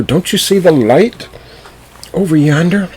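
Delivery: high, loud rooster voice. A narrator's reading. Rooster insists to the donkey, in a high loud voice. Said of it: "said the rooster".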